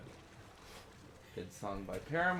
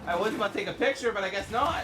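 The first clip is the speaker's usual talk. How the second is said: excited outburst; 11 semitones higher, 8 dB louder, faster than usual. Real, not acted. Missed photo moment.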